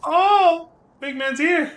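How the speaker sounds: high, loud cries of joyful recognition; overjoyed greeting. Acted. Game character greeting Biggs and Wedge at their reunion, overjoyed. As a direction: call out to long-lost friends, easing down at the end.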